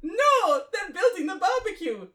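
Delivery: high-pitched attempted Spanish accent; [name]. Leader explains the barbecue, high hammed-up Spanish accent. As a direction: exclaim high, then stretch the last words right out. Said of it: "[name] Spanish".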